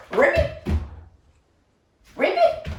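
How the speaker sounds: high, squeaky frog call; angry frog ribbit. Pretend. Teacher calling frog sounds for the angry hops, very high. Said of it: "really angry hops".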